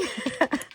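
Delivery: short high chuckle; real little giggle; amused laugh. Real, not acted. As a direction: laugh at your own small slip. Laughs at not seeing camera.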